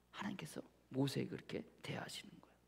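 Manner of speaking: hushed whispered conclusion. Acted. Preacher whispers how God treats Moses, hushed. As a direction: let it fall to a whisper. Closing the story softly.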